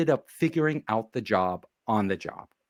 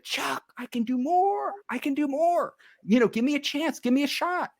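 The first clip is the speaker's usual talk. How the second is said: much higher, whiny begging; mock pleading with the boss for a chance; 11 semitones higher than usual. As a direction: beg the boss like an eager kid.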